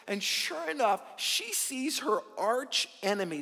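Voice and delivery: normal talking voice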